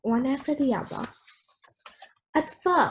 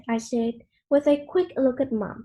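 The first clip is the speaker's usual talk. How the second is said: ordinary delivery, nothing marked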